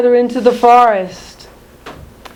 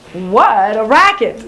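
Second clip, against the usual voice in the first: usual speaking voice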